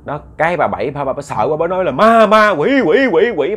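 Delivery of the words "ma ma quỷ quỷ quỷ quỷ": high, loud repeated shrieks of alarm; terrified screaming. Retold or imitated. Frightened wife screams about ghosts to her husband, panicked yell. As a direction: cry out each word high and loud in panic. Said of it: "bà la vậy đó".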